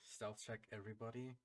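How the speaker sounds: hushed. Acted. Player calls for stealth check while sneaking, hushed. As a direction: keep it down for the party. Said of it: "trying to whisper and stuff".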